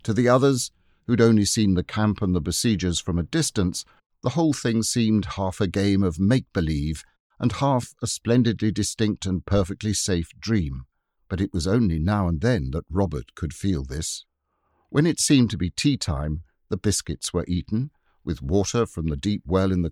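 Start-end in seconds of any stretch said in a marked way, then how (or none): none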